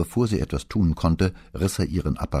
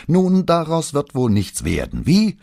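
loud, high-pitched angry sarcasm; furious, raised voice; undisguised fury; 8 dB louder than usual. A narrator's reading. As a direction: let the rage show, ending on a piercing question. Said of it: "ohne einen Versuch, seine Wut zu verhehlen".

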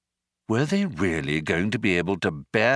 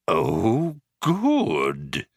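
astonished whirr. Acted. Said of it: "in considerable astonishment".

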